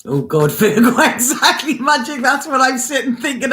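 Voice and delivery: high, giggling through the words; amused, laughing to herself. Real, not acted. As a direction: laugh at what might come. Laughs on turning to Virgo.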